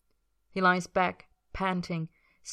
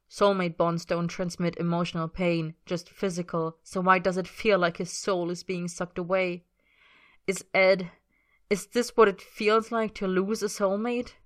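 ordinary delivery, nothing marked

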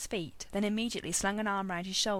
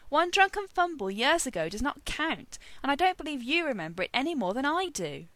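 higher, emphatic, indignant objection; protesting; 6 semitones higher, 5 dB louder than usual. A narrator's reading. Merlin objecting to Arthur's reminder of a fumble, protesting. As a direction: protest it firmly and loudly. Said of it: "Merlin protested".

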